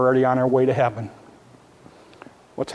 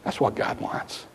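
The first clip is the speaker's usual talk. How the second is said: breathy whispered statement, barely audible; 9 dB quieter than usual. Real, not acted. Pastor whispering to the congregation, hushed whisper. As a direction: let it drop to a whisper. Whispered summary after God's voice.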